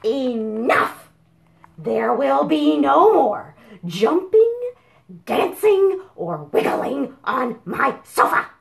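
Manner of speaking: loud, firm laying down the law; angry scolding. Acted. Chicken Sofa forbids all fun, loud and firm. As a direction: put your foot down hard.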